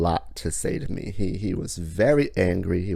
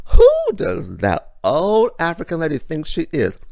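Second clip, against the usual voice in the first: mock-indignant, high and loud; scornful imitation; 10 semitones higher, 8 dB louder than usual. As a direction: sneer it like a judgmental onlooker. Imagined scornful remark about him.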